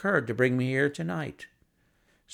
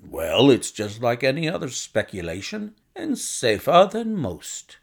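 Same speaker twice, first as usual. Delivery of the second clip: bustling visitor; 4 dB louder than usual. Acted. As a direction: make a bluff, confident proposition. Narrator voices the visitor Blessington.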